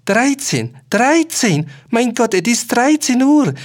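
high, loud horrified cry; shocked old men crying out the hour; panicked. A narrator's reading.

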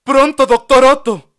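high, loud urgent summons; urgent call. A narrator's reading. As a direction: call the doctor in a hurry. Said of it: "convocó Sor Flor".

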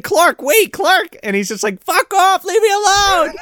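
very high, loud panicked cries; frantic yelling. Retold or imitated. Host voices panicked fleeing kid, high and loud. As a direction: yell it in frantic mock terror.

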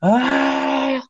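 high-pitched mock scream from a nightmare; re-enacted yell. Dreamer yells to alert others of danger, re-enacted high yell. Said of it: "I yell to the top of my voice".

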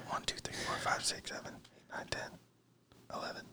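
counting under the breath; whispering. Real, not acted. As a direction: whisper each number.

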